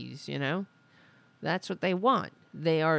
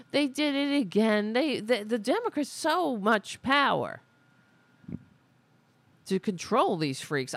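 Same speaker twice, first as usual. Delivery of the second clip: higher-pitched mock grievance; mock whining; 6 semitones higher than usual. Host mimics party blaming the Democrats, mock whining. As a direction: mimic someone pinning blame on others.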